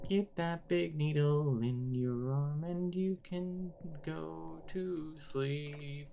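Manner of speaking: sinister lullaby of a threat; creepy nurse voice. Acted. Spooky asylum nurse threat.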